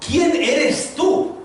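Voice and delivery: high, very slow challenge; preacher asking slowly and pointedly; probing question. Acted. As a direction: draw out each word to make them think.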